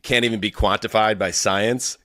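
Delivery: usual speaking voice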